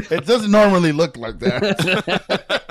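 slow, loud, high comic excuse; laughing excuse. Retold or imitated. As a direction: defend yourself slowly through laughter. Retold embarrassed excuse to nurse.

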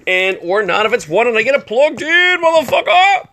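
rising to a high triumphant yell. Real, not acted. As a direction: shout in victory. Triumph plugging in the phone.

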